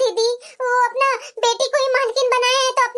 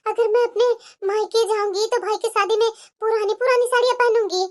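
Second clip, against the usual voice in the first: normal talking voice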